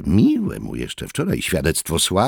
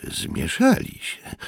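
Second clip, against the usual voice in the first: ordinary delivery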